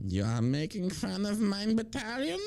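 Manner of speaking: offended camp German officer; indignant, drawn-out German-accented voice; mock German accent.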